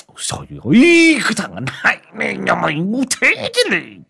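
high furious shriek; shrieking in anger. Acted. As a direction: shriek at the useless boy. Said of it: "Ông Bảnh rít lên".

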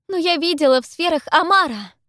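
high, loud protest; urgent insistence. A narrator's reading. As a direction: insist over their laughter. Insisting over mocking laughter.

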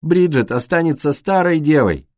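mildly shouted taunt; shouted chorus. A narrator's reading. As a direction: call it out like a jeering gang. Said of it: "хором прокричали мальчики".

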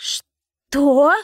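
high, loud gasped question; shocked exclamation. A narrator's reading. Darina hears the slave is free, exclaiming in shock. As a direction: leap up in pitch with disbelief. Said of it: "воскликнула Дарина".

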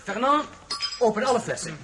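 high, loud, elated order; celebrate, call for the wine; jubilant call. Acted. Prince orders the servant to open the wine, elated call.

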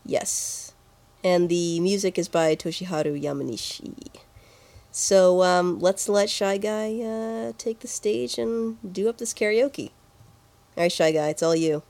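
ordinary delivery

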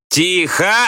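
high, sharp bark for silence; barked order. A narrator's reading. Teacher silences the noisy class, barking. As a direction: bark it over the din. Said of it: "гаркнул Слушкин".

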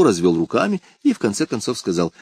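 ordinary delivery, nothing marked

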